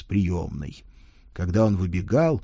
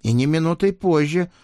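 put-on high, loud kid confirming; child voice; 9 semitones higher, 4 dB louder than usual. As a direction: repeat it firmly. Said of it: "подтвердил Егор".

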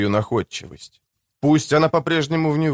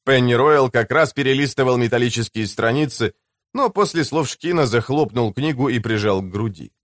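plain reading voice

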